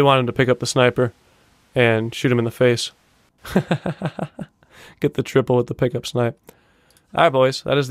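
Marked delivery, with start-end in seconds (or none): 3.4-4.4 quick, higher-pitched chuckling; amused laugh. Real, not acted. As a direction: chuckle at the missed chance. Laughs after the sniper clip.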